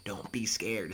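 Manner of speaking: whisper. Real, not acted. Reassuring students.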